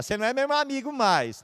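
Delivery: high, petulant mock child voice; mock childish pout; childish complaint. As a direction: say it like a hurt kid. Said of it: "vai chegar pra pessoa e falar".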